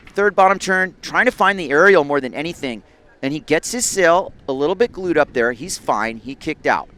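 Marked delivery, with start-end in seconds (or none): 0.1-4.9 excited play-by-play. Real, not acted. Excited live call of ride.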